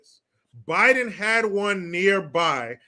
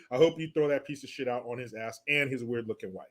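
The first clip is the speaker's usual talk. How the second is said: ordinary delivery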